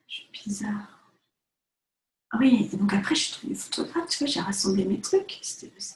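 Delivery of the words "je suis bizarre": whispered confession. Real, not acted. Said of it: "confessing quietly".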